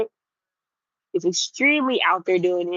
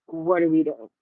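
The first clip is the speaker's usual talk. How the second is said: low, muted disbelief, falling away; low-key dismay; 4 semitones lower than usual. Retold or imitated. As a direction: ask it low, as a let-down reaction. Retold reaction to casting, first take.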